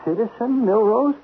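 shocked disbelief. Acted. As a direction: echo the demotion in stunned panic. Demoted to citizen, shocked.

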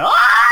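high loud shriek; banshee scream. Retold or imitated. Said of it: "my mom comes screaming out like a banshee".

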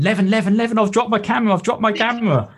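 high, frantic retold call for help; panicked calling. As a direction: call out his name in a rising panic. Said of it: "And I'm panicking".

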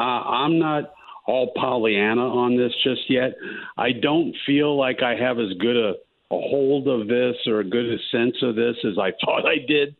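ordinary delivery

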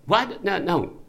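sharp high startled what; jolted by the suggestion; shocked disbelief. Acted. Husband startled at being offered a Donna substitute, shocked. Shocked at replacement partner idea.

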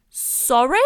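sorry shouted in disbelief; loud shocked sorry. Real, not acted. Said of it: "reacting to lyric".

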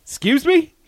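a startled bartender, pitch jumping up; mock baffled barman; bartender's surprise. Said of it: "And he's like".

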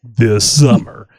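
slow mock trailer voice; a touch of movie announcer. Joins the trailer bit.

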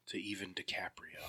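whispered joke under the breath. Real, not acted. Co-host whispering a joke about the manuscript theories.

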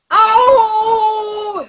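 very high, loud pained shout; shrill fake pain scream in the ring; loud cry. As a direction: howl it out as loud as possible. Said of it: "as loud as I could".

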